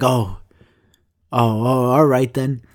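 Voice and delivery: imitating a mildly let-down neighbor; halting, deflated "oh" as if let down; disappointed. Neighbor reacting to the pizza answer, disappointed. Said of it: "he looked very disappointed in me".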